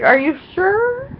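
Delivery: high put-on mom voice, fussing; imitating mom. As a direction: mimic a mother insisting kindly. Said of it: "And my mom's just like".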